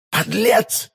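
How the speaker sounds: high-pitched indignant exclamation; outraged cry. A narrator's reading. Milady learning of the ring, outraged cry. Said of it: "вскричала миледи".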